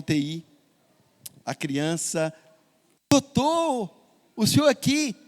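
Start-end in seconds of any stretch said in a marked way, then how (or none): 3.1-5.2 put-on a little boy delighted to see the doctor; surprised child. Child surprised doctor visits ICU.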